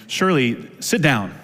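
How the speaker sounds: ordinary delivery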